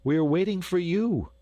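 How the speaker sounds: high, impatient call down the line; urgent high-pitched summons. A narrator's reading. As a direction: call to a straggler, pitched well up. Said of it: "cried Lambert from the line".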